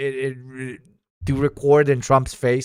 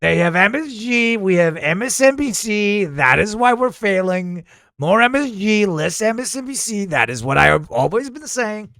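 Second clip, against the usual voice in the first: higher, emphatic mock-politician voice; boastful politician character; 9 semitones higher than usual. Remarking on the team's strong handshakes, boastful politician voice. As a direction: play a blustering leader with swooping stresses. Comic political figure riffing on food.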